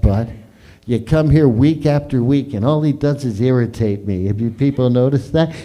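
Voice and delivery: ordinary talk, nothing marked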